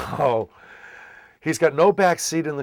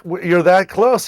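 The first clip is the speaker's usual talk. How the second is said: surprised; 7 semitones higher, 10 dB louder than usual. Retold or imitated. Stunned at grandfather's nearness.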